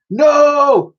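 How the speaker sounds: high, loud horrified no; scream. Acted. Dotty seeing Titch fall, horrified scream. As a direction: shriek at the sight. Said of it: "screamed Dotty".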